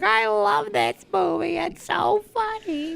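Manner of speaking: high slow squeaky voice; mom impression. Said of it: "She was like".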